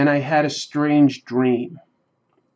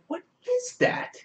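very high, slow, breathless wonder; squeaky astonished "what is that" while staring at the sky; baffled exclamation; 8 dB quieter than usual. Retold or imitated. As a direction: gasp it out like you can't believe your eyes. Puzzled quote on seeing lights.

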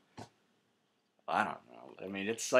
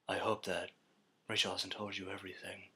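whispered Bale voice; 8 semitones lower, 16 dB quieter, faster than usual. Retold or imitated.

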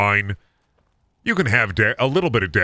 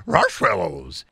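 ordinary delivery

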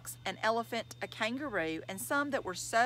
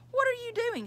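very high teasing sing-song; mock a little brother in a high lilt; 3 dB louder than usual. A narrator's reading. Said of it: "she teased".

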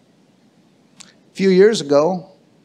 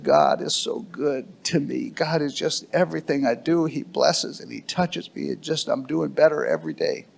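retold tearful quote; 8 dB quieter than usual. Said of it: "He started crying".

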